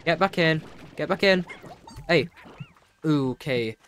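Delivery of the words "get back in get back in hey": loud, repeated herding calls; alarmed, louder calls to stop escapees; urgent calling out. Real, not acted. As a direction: shout them back into the pen. Calling escaping creatures back in.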